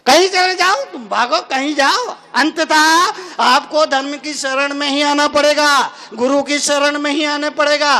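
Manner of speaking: preaching. Acted. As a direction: drive home the point to the crowd. Preacher exhorting the crowd.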